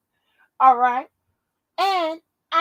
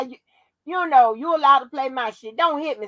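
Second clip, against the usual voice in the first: ordinary talk, nothing marked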